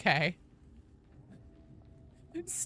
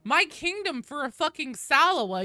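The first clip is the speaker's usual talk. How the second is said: high, loud, ranting disbelief; incredulous outrage; 10 semitones higher, 7 dB louder than usual. Real, not acted. Streamer envious of a viewer finding a Ledex, incredulous outrage. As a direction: blurt it out, outraged and pitched way up. Mock outrage at another's lucky find.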